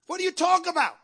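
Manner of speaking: high, loud repeated challenge; emphatic preacher's demand. Real, not acted. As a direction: cry the question out at the congregation. Same question repeated as a cry.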